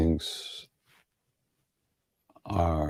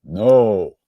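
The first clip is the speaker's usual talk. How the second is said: a loud, booming "No"; one loud, playful boom of "No"; big booming voice; 7 dB louder than usual. Retold or imitated. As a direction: boom it out, a mock-stern refusal. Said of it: "in a big booming voice go".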